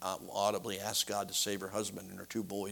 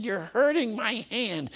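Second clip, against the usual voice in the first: high, slow complaining woman's voice; much higher pained protest; hurt woman; 13 semitones higher, 6 dB louder, slower than usual. Retold or imitated. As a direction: draw it out high like she's in pain. Woman protests his grip.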